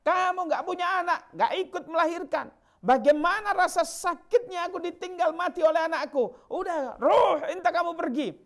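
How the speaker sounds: high, loud, bitter outburst; furious grieving woman. Acted. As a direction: hurl it at him in raw grief. Grieving woman lashes out at Rasulullah.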